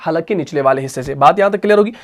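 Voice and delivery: ordinary delivery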